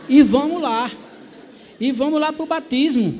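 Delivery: loud, high, cheerful send-off; rally them, bright and loud; eager call. Retold or imitated. Husband cheering the family to the baptism, loud and eager.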